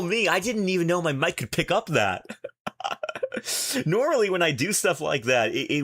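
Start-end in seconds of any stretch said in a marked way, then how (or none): 2.3-3.8 airy high cackle; breathless hen-like giggle; chicken cackling. Real, not acted. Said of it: "That's straight up chicken cackling".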